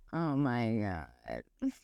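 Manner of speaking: low, soft, very drawn-out dismay; mortified. Retold or imitated. Woman learning all her leggings are see-through, slow low mortification. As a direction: sink right down and drag it out slowly.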